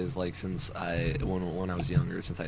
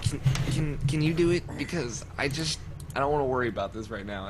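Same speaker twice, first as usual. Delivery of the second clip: nervous mom; 6 semitones higher than usual. Retold or imitated. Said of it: "She's like".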